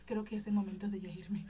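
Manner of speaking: breathy whisper to herself in the mirror. Retold or imitated. Tipsy girl in the bathroom mirror tells herself to go, whispering. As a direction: whisper it as a private decision. Telling herself to leave, drunk.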